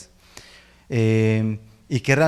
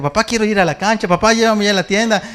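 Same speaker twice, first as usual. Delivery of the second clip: high, fast mock-whiny begging; pleading kid; 9 semitones higher, 6 dB louder, faster than usual. Son begging dad for rides, high whiny nagging. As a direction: nag dad like a little kid. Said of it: "ya no está de que".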